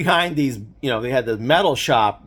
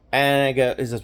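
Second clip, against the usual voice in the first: normal talking voice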